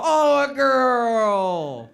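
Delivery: put-on slow, loud gushing Oh, a girl; mock delight. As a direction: gush over the news like a doting aunt.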